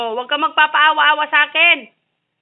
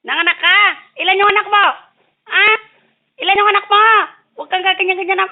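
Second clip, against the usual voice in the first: higher, louder playful grilling; mock interrogation; 4 semitones higher, 8 dB louder, faster than usual. Grilling the cat about her kittens, playful. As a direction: demand answers from the cat in jest.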